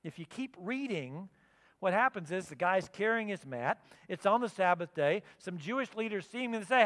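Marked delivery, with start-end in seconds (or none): none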